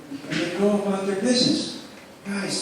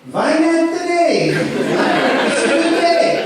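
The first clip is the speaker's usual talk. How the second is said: high, slow voice of God; God's voice; 10 semitones higher, 5 dB louder, much slower than usual. Acted. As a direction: answer as a divine voice.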